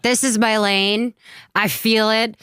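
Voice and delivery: high, hammed-up certainty; dramatic teen conviction. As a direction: declare it like a rock star wannabe. Retells an old teen dream.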